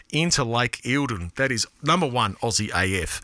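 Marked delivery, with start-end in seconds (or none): none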